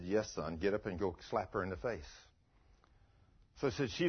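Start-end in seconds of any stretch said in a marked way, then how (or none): 0.0-2.1 low, quiet voice of God; retold order, soft and low; calm command. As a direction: answer low and unruffled. God answering priest calmly.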